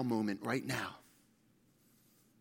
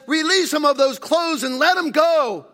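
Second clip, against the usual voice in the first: high, very loud proclamation; shouted command; 11 semitones higher, 14 dB louder than usual. Acted. Jesus ordering Lazarus's release at the tomb, shouted command. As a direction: order the bystanders at full volume.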